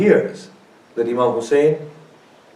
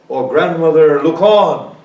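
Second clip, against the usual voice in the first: crying out; 5 semitones higher, 11 dB louder than usual. Acted. Hussein bids her look again.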